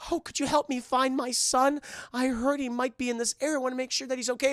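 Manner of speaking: high, polite cartoon old-lady voice; little old woman. Old woman seeking her son, politely pleading. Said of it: "it's a little old woman".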